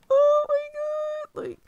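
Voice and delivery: high, slow, stunned oh my god; stunned excitement. Real, not acted. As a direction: let it out slowly, amazed. Overwhelmed by the news.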